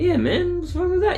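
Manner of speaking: high, mock innocent shrug; defensive. Me brushing off old timer, high mock innocence. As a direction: play it off all innocent. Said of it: "I'm like".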